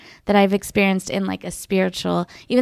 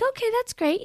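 much higher, sweetly humouring; humouring mom voice; 14 semitones higher, 5 dB quieter than usual. Retold or imitated. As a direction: play along like a patient mom. Humouring her child's claim.

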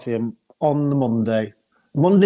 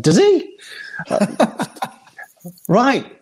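mock surprise; 8 semitones higher, much slower than usual. Surprised at brother's location.

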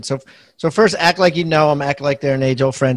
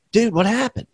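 put-on light, offhand and a bit higher, like a text to an old friend; casual buddy text; 8 semitones higher than usual. Host mimics the agent's casual text to sellers, offhand and higher. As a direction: toss it off like you've known him for years. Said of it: "He just put".

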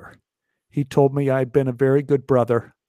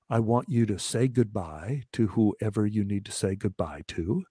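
grave surgeon; 5 semitones lower, 5 dB quieter than usual. Retold or imitated. Surgeon gives grim news.

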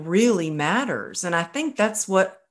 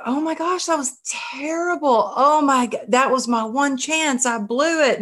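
high-pitched panicky replay of regret; retold panic; 7 semitones higher than usual. After a flubbed Clubhouse talk, high mock panic. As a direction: wail it like you just ruined everything. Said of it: "I was like".

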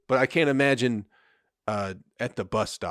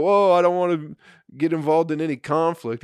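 mock bystander; 7 semitones higher, 7 dB louder than usual.

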